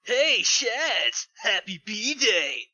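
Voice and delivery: cheerful. Acted. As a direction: greet a rival like an old pal. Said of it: "Sonic said cheerfully".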